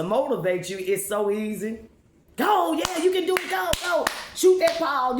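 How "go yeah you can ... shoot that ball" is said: excited cheering. Pretend. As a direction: cheer them on from the sidelines. Cheering on ball players.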